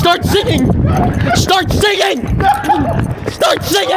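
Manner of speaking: high shouted command, over and over; mock order. Host holding water gun on Jacob, yelling high. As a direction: bark it like a drill sergeant at a prank.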